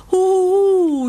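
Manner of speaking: high, loud puppy howl. Acted. Lonely puppy lost in the woods, howling. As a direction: howl out alone like a lost pup. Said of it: "jault Bandit".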